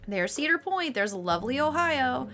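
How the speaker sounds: ordinary delivery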